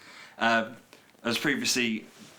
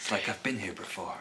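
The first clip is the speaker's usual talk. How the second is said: soft, whispered mock-mystery; low, hushed spooky line; eerie déjà vu; 3 semitones lower, 5 dB quieter than usual. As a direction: whisper it as if haunted by a memory.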